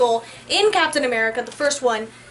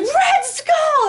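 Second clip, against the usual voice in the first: high excited cry of the name; imitating a friend in the theater, a high mock shout; 13 semitones higher, 3 dB louder than usual. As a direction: shout it out like a fan who just recognized him. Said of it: "He's like".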